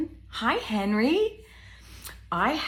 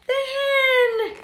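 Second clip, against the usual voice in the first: high, stretched cooing; squealed delight for the children; delighted exclamation; 6 dB louder than usual. Pretend. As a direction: sing it out with glee. Delighted reaction to reveal.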